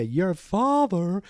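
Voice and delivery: slow, high mocking echo; mocking bully. Film fan plays the gang jeering at the kid, slow mockery. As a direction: stretch it out in a jeering put-on voice. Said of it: "And they, like, say".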